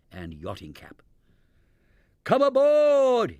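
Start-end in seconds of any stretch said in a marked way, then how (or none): 2.2-3.4 high, loud, slow boom; booming delight. A narrator's reading. Cousin Teddington welcoming the stranded party, booming. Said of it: "he boomed delightedly".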